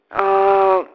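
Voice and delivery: ordinary delivery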